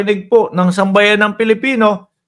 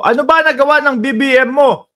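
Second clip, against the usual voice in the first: mocking quote; 8 semitones higher than usual. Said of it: "Baka sabihin mo".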